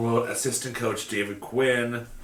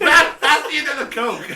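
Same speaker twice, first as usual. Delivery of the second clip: high, loud, laughing put-on voice; mock Kessel voice; 9 dB louder than usual. Cohost jokes in the same mocked voice, high and loud, laughing. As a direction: lampoon him through laughter.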